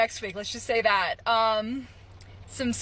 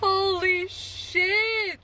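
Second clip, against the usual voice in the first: stunned; 10 semitones higher, much slower than usual. Real, not acted. Reacting to being fired.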